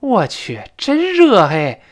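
slow, high, loud moan about the heat; mock annoyed whine; annoyed complaint. Shown as a demonstration. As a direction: groan at the heat. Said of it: "表示他恼火".

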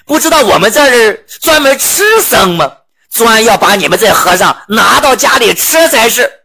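mock the monk as a meal; demons' voice. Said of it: "群妖一听".

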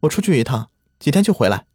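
calling out. A narrator's reading. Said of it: "她对灵儿喊道".

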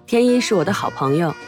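ordinary talk, nothing marked

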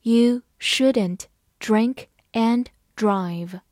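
slow, word by word; measured teacher's model reading. Shown as a demonstration. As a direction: read slowly with pauses between words. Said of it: "慢慢来读".

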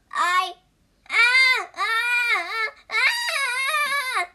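loud, high drawn-out "aah"; repeated high howls of pain; cry of pain. Acted. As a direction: wail in acted agony. Monkey tortured by headband spell.

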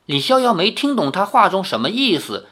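usual speaking voice

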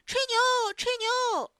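high, loud jeering yells; clamouring. A narrator's reading. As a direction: yell it like a crowd of taunting kids. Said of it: "也嚷嚷起来".

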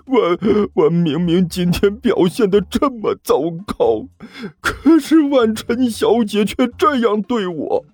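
high, slow, sobbing words; crying. A narrator's reading. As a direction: speak through the sobs. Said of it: "一滴泪水".

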